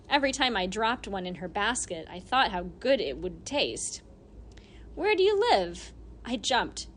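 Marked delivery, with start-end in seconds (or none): 5.0-5.9 high, loud sudden question; old lady voice. Acted. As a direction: startle him with the question.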